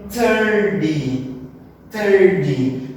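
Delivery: "thirty" with a drawn-out first syllable, slow; THIR-ty, falling away at the end; stress on THIR. Shown as a demonstration. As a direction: stretch and punch the start of "thirty". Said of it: "aqui ela está no comecinho".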